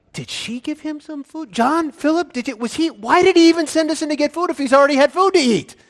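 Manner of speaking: high, flustered, stumbling questions; confused disciples. Acted. Disciples asking each other about the food, confused. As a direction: rush it in baffled, high-pitched bewilderment. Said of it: "They're so confused".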